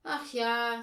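loud, drawn sigh of regret; troubled sigh. A narrator's reading. Paul wishes they had the key, troubled sigh. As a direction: sigh it out heavily. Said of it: "seufzte Paul bekümmert".

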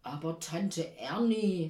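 loud, slow, drawn-out appeal; Nikolaus voice. A narrator's reading. Nikolaus outside pleading with the aunt, in a slow big voice. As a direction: gently chide through a closed door, stretching the name. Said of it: "sagt draußen der Nikolaus".

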